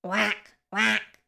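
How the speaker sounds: two short storybook quacks; brief, separate quacks; duck quack. A narrator's reading. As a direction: imitate a duck calling. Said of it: "called the duck".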